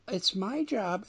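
high, slow nineties Valley girl imitation; mock a nineties Valley girl, drawn out and high. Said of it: "she talks like a nineties Valley girl".

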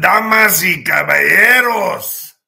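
booming slow ring announcer; lucha libre announcer. Pretend. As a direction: announce it to the arena. Opening announcer greeting.

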